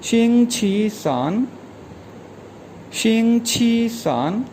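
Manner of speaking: शिंग छी सान said slowly with a rising end; patient teacher modelling शिंग छी सान; slow model word. Shown as a demonstration. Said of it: "ये प्रोनाउंस किया जाएगा".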